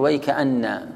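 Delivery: "ويك أن" joined slowly as wayka'anna, halting on the nn; stop on whole word. Shown as a demonstration. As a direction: draw out "ويك أن" whole to its doubled n. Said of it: "ومنهم من يقف عليها كامل".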